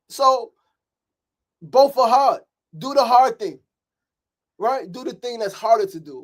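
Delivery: high, loud preacher's fire; fiery preaching. Real, not acted. Mentor fires up the men's group in a loud, high preach. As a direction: preach it at full force to the brothers. Pep talk rises to preaching.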